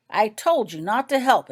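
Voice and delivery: admonishing. A narrator's reading. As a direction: scold her from far off. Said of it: "admonished Healer Winonan from across the room".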